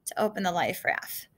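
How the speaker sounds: plain reading voice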